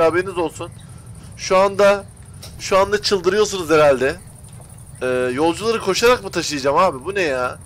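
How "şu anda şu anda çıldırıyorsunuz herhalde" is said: excited. Real, not acted. Teasing viewers about failure.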